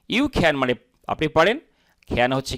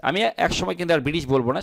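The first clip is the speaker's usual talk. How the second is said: ordinary delivery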